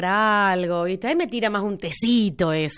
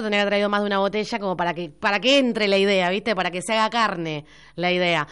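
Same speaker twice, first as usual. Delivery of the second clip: normal talking voice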